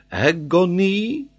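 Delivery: AGONY high, loud, rising at the end; emphatic French geographer. Acted. Geographer offers agony as a reading, emphatic. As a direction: stretch agony out with a French lift. Paganel lists possible words.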